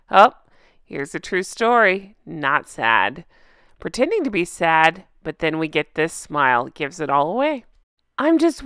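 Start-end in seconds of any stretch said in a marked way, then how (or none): 2.3-3.2 deadpan. Pretend. Punchline after true story.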